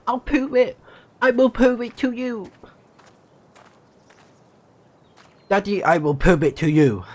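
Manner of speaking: put-on high, childish whine, dropping the r in prove; mocking a little kid. Mocking the game kid who says prove it, childish voice. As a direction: tease like a small kid who can't talk properly. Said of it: "he can't speak properly".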